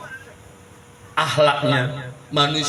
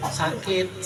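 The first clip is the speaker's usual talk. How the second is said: quiet reply; 3 semitones higher, 6 dB quieter than usual. Acted. [name] answers the Prophet.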